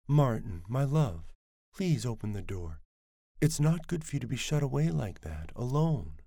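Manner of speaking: lightly voiced mother coaxing through a door. A narrator's reading. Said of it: "her languid, liquid voice".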